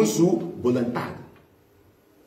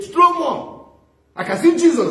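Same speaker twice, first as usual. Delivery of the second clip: much higher, louder cry of triumph; exultant cry; 11 semitones higher, 4 dB louder than usual. Retold or imitated. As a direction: call out joyfully to the crowd throwing stones. Stephen under stones, exultant.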